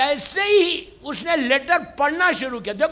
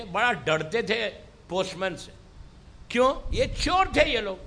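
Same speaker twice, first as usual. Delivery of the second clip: ordinary talk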